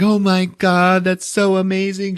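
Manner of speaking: high, slow doting coo; mock mother gushing over bunny suit; gushing mom. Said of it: "The mom's like".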